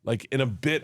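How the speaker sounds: ordinary talk, nothing marked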